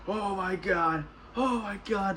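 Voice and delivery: high, put-on groaning dismay; imitated suffering. Contestants eating dog food, mock suffering. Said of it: "they're all suffering".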